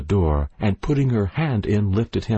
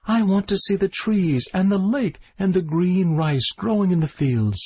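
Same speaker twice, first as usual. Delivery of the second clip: high, wistful bird voice; the bird yearns for the trees and the lake, high; longing little bird; 11 semitones higher than usual. A narrator's reading. Bird longs for freedom.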